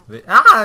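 loud, high yelp; sudden yell. Real, not acted. Player yelps as food slips away, sudden yell. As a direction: cry out in sudden surprise. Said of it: "Niye bağırdın?".

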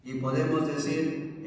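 ordinary delivery

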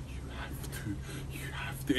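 whispered urging, imitating his wife; whispering. Imitating the wife whispering from behind the phone. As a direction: hiss it like she's egging you on. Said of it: "my missus ... going like this".

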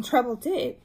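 plain reading voice

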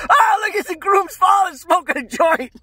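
high-pitched mock shout; loud, piercing falsetto outcry; wedding crowd exclaiming. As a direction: imitate a whole crowd shrieking at a scandal. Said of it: "The whole wedding party's like".

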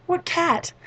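high-pitched incredulous question; retold squeak of confusion; mock bafflement. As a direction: pitch it right up, puzzled. Baffled reply to friends.

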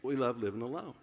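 laughing retake. Pretend. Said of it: "I'm gonna tell it just like this".

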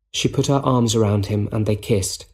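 usual speaking voice

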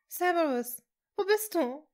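high, loud, panicky sobbing call; desperate tearful search call; panicked sobbing. A narrator's reading. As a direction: cry out for him in panic. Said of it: "schluchzte Hermine panisch".

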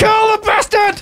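high, drawn-out battle cry; slow, high yell; urgent shout. Real, not acted. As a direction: egg the team on, pitched high. Urging on teammates in fight.

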